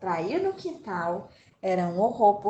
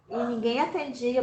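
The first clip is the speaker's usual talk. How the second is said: ordinary talk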